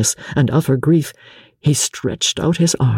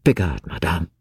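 softer, lower and gentle; 8 semitones lower, 4 dB quieter than usual. A narrator's reading. Husband gently calming his sobbing wife, softer and lower. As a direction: soothe her kindly. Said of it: "he added very gently".

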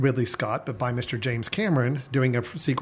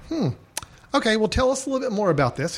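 higher studio executive voice, a bit quick; high-pitched nasal voice; 8 semitones higher, faster than usual. Retold or imitated. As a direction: play the pushy studio suit, higher. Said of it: "a little more high-pitched, uh, nasally voice".

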